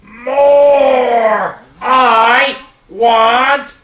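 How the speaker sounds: high, loud, greedy wail with stretched words. Acted. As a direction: bellow it over and over, drawn out. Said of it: "And he still cried".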